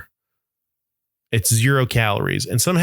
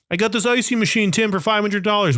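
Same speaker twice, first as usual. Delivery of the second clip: whiny excuse voice; 7 semitones higher than usual. Retold or imitated. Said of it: "And you're like".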